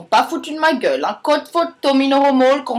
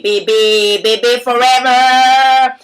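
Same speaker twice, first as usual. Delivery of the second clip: slow, louder sultry film-star purr; Brigitte Bardot; 5 dB louder, slower than usual. Acted. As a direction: try the iconic breathy star voice again, hammed. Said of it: "comment je fais le bébé". (Brigitte Bardot imitée lentement et plus fort)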